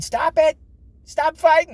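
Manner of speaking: high, loud frantic shout; mother screaming. Retold or imitated. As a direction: yell over the brawl to break it up. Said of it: "My mother would be screaming".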